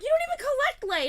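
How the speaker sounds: very high, loud accusing shout; indignant yell. Retold or imitated. Arguing with Allison about who collects Lay, shrill indignant shout. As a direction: hammer the accusation at her friend. Retold argument with friend.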